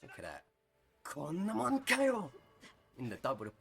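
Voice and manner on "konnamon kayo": high, loud, slow anime yell; hammed-up Japanese battle cry; anime shout. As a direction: belt it like a furious anime hero. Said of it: "Let me hear that again".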